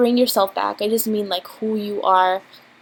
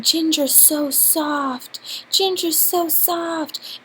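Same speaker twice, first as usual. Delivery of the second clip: put-on higher, slow cooing baby talk; 6 semitones higher, much slower than usual. Cooing to her dog Ginger, baby voice. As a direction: coo it slowly to the dog. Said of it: "I was using a baby voice".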